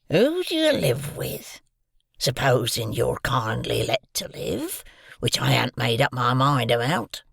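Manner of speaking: put-on loom over the boy and growl it; menacing convict. A narrator's reading.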